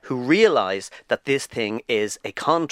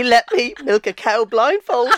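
mock prank caller; 10 semitones higher, 6 dB louder than usual. Prank caller voiced on radio.

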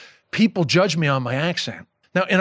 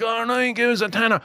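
higher-pitched, overdone Dublin street accent; exaggerated Dublin accent; 7 semitones higher than usual. Shown as a demonstration. Guest showing the hosts an accent unlike their own, exaggerated accent. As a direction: put on a thick Dublin accent as a mocking example. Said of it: "I'm not saying I'm like that accent".

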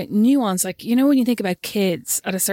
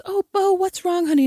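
imitated teacher; 10 semitones higher than usual. Teacher asking sobbing pupil what's wrong, imitated high cooing voice. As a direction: coo over an upset little one. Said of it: "his teacher comes down and goes".

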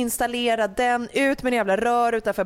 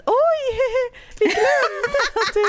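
much higher, laughing mock of mom; much slower than usual. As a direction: squeal it like a careless giggling mother. Mamma citeras, skrattande. (hög, fnittrande härmning av mamma)

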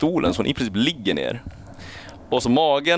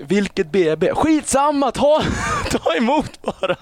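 frantic staff; 13 semitones higher than usual. Retold or imitated. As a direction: play the rushed nurses, half laughing. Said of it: "Så de är ut med rullstolar och så här".

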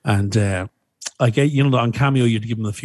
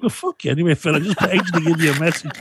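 mock indignant retort; 7 semitones higher than usual. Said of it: "I was like".

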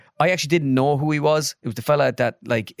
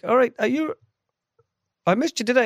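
much higher, puzzled voice; surprised manager; 12 semitones higher than usual. Retold or imitated. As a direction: sound baffled by the name.

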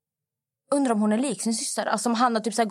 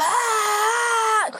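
sudden high pained cry; retold scream of pain; 12 semitones higher than usual. Girl retelling falling to the floor, sharp high scream. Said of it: "ramlar ner på golvet och skriker".

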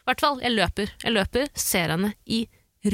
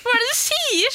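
high indignant retort. Retold or imitated. Retelling her own shocked comeback to the customer, high and outraged. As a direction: squeal it in disbelief. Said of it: "Og så sier jeg".

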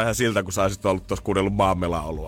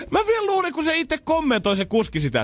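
excited. Real, not acted. Excited laughing recount.

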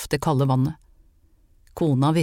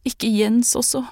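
much higher, a mild sob in it; mother's despair breaking through, pitched up; sobbing plea; 7 semitones higher than usual. A narrator's reading. Mother fears for her son, mild sobbing high plea. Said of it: "Moren slapp fra seg et hulk".

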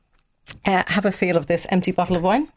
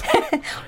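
sudden high-pitched laugh; high squeal of a laugh. Real, not acted. Laughs at the grapes joke.

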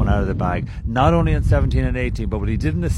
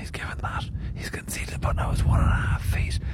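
whispering; 5 dB quieter than usual. Real, not acted. Hushed golf commentary beside green.

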